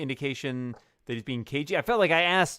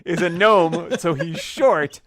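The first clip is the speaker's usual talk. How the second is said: exasperated mock retelling; 6 semitones higher, 8 dB louder, slower than usual. Real, not acted. Player mocks GM's description.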